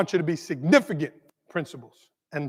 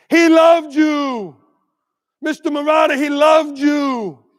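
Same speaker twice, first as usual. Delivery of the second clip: high, drawn-out anguished shout; retold scream; 7 semitones higher, 8 dB louder, slower than usual. Bereaved mom to her son's teacher, retold scream. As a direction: imitate a mother screaming it out slowly at full voice. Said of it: "She screamed at me".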